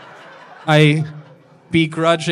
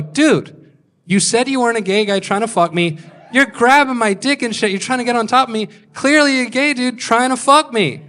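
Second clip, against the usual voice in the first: retold confrontation; 6 semitones higher than usual. Angry confrontation in bedroom.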